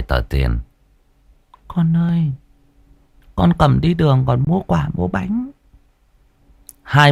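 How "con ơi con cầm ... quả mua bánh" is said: higher, softened grandmother's voice; bà nội dặn dò. A narrator's reading. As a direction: coax a grandchild gently while handing over money. Grandmother's remembered words voiced in story. (giọng bà nội dịu dàng dặn cháu)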